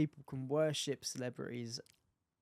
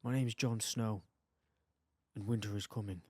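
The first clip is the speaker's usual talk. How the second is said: Jon Snow impression; 8 semitones lower, 6 dB quieter than usual. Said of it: "[name]'s brilliant accents".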